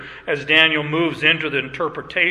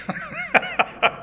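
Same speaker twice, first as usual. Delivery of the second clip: high-pitched laugh; 5 dB quieter than usual. Real, not acted. Laughing at dumb cattle.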